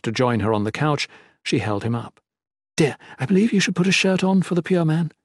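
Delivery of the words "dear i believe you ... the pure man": suggesting. Acted. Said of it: "she suggested".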